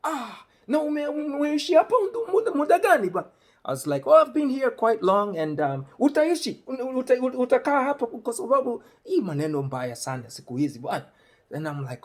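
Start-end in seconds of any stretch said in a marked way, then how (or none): 0.0-3.3 high, fast, loud incredulous Swahili; incredulous questioning. Retold or imitated. Questioning a stranger in line about staying, high and incredulous. 4.1-11.0 animated retold exchange. Acts out the Swahili exchange.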